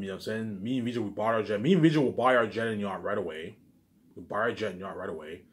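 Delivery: normal talking voice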